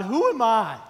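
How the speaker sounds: loud, pained cry to God; loud, pleading Moses; protesting Moses. Retold or imitated. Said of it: "Moses protested to God".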